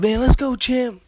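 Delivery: mock motivational shout; hype-man motivation. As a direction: pump him up like a cornerman. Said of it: "do that motivation".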